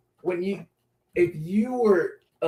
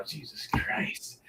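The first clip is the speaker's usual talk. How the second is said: slow, breathy whisper under the breath; whispered aside; 12 dB quieter, much slower than usual. Real, not acted. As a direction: whisper it to yourself. Aside, muttered under his breath.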